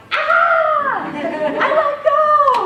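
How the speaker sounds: high, loud shriek, slowly drawn out with a laugh; child's squeal on the tracks; gleeful scream. Retold or imitated. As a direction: shriek it like a thrilled kid. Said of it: "I'd go".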